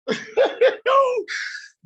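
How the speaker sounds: high-pitched burst of laughter; high squealing laugh. Real, not acted. Speaker laughing at his own advice, high squeal.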